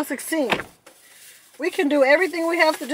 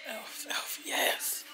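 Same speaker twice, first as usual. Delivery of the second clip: whisper; 10 dB quieter, much slower than usual. Real, not acted. Spotting brand in store.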